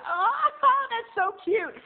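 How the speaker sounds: high, loud gushing squeal; squealing delight. Retold or imitated. Friend squealing over the butt on the shower glass, high and loud. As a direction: gush like it's adorable. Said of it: "she went".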